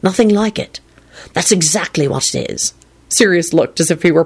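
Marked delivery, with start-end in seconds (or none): 0.0-2.7 lovesick. Acted. Continues lovestruck.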